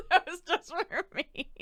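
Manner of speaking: "That was just for me." through giggles; slow and laughing it out. Real, not acted.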